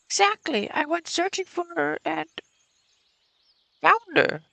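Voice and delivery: put-on high, slow old lady voice. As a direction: play a stern old woman. Voices Olga Dmitrievna.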